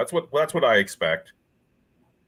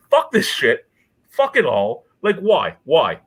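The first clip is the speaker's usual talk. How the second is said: loud, punchy exasperation; exasperated rant; 6 dB louder, slower than usual. Real, not acted. Beer drinker fed up with hoppy red ales, exasperated rant. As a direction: burst out fed up, hitting each short phrase hard.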